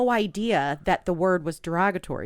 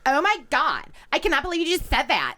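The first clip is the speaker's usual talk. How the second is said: a prissy gasp of disbelief in a higher voice; mocking prissy friend; 7 semitones higher than usual. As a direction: ham up the shocked classmate. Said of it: "She didn't talk like that".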